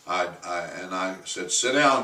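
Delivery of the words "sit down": loud, higher order; firm command. Retold or imitated. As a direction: stop him in his tracks. Pastor ordering George to sit.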